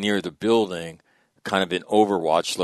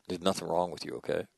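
quiet reassurance; 8 dB quieter, faster than usual. Retold or imitated. Reassuring the struggling young Marine.

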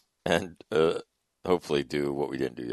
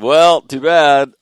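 mock sympathy; 10 semitones higher, 15 dB louder, much slower than usual. Teasing nephew about going back.

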